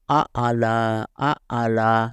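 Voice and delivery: آ plain then ع from throat vibration; alif versus ain. Shown as a demonstration. Teacher contrasting alif and ain sounds aloud. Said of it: "اس طرح".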